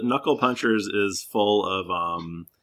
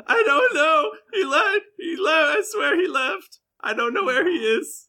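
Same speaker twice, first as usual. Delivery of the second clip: very high, pleading crying voice; sobbing terror. Acted. Terrified girlfriend pleads with the intimidating adventurer, crying. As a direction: beg tearfully that you know nothing.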